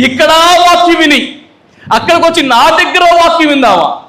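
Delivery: preacher praising a devoted woman to his church; fervent preaching. Acted. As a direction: testify high to the congregation. Preaching at full force.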